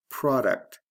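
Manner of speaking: "product" as PRAH-duct, first syllable stressed; short O. Shown as a demonstration. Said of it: "product has a short O".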